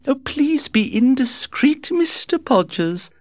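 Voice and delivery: high, chirpy, breezy society lady; gushing society hostess. A narrator's reading. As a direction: flutter through it like a gossiping hostess. Lady Windermere interrupts.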